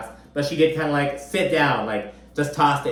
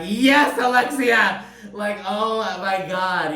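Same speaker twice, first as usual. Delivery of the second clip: excited cheering; 7 semitones higher, slower than usual. Retold or imitated. Host re-enacting cheering on a castmate's comeback, excited. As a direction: whoop it up like a delighted fan.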